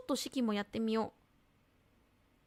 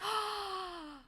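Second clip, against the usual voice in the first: surprised gasp; 9 semitones higher, 6 dB quieter than usual. Real, not acted. 3DS suddenly works.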